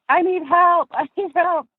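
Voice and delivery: put-on high, childlike call for help; mock child yell. Small boy calling his dad from the dock, high and pleading. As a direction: squeak it out like a panicked kid. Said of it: "I remember yelling for my dad".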